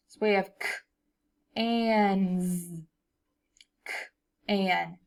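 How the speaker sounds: slow separate sounds; sounding out words. Shown as a demonstration. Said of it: "let's sound it out".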